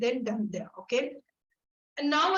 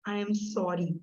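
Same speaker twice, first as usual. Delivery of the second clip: slow gentle; 4 semitones lower, slower than usual. Shown as a demonstration. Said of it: "The first phase starts with".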